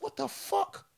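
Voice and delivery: high, stunned thinking aloud; shocked inner thought. Retold or imitated. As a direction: react in disbelief to your own stabbing. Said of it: "I thought".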